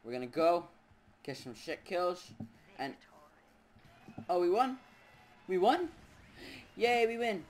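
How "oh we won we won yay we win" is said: excited. Real, not acted. Sudden victory in match.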